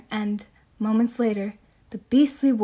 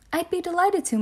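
put-on girl's voice; 7 semitones higher than usual. Girl's voice in read story.

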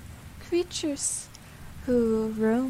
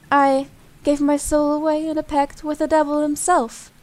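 angry rant; 3 semitones higher, 6 dB louder than usual. Acted. Rising rant about rotten world.

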